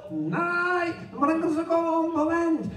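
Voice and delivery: high, drawn-out shouted exchange; calling out. A narrator's reading. Jesus and puzzled Peter talk across the sea, calling out. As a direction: call each word across the water.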